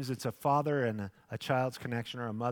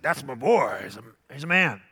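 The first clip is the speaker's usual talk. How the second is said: proud dad quote; 8 dB louder than usual. Retold or imitated. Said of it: "He's like".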